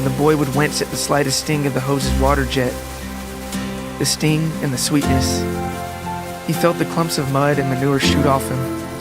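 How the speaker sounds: ordinary delivery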